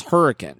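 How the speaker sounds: "hurricane" with a reduced last syllable, -kin; local pronunciation. Shown as a demonstration. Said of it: "it's spelled just like hurricane".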